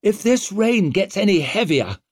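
high, irritated growl; growled complaint. A narrator's reading. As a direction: growl it over the downpour. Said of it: "growled Savine".

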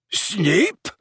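high, startled outburst; shocked exclamation. A narrator's reading. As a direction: blurt it out in shock. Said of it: "ejaculated Slughorn".

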